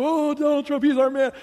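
high, loud gushing fan voice; mock the eager supporter. Pastor mocking supporters, high, loud and gushing.

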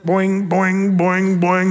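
bouncy boing sound effect; mock bouncing noise. Preacher imagining Lazarus hopping out, playful boings.

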